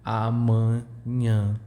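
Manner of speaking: Amanhã drawn out slowly, both nasal vowels through the nose. Shown as a demonstration. The teacher slowly shows the two nasal sounds. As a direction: stretch amanhã and let the nasals ring. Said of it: "We have two nasal sounds".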